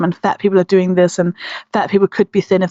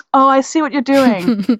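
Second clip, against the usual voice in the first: knowing realization; 5 semitones higher than usual. Retold or imitated. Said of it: "And I was like".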